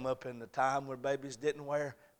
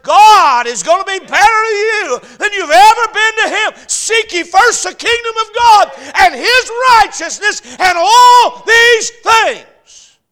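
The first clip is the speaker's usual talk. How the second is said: building to full-force preaching; 9 semitones higher, 21 dB louder than usual. Real, not acted. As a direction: climb steadily into a roaring sermon climax.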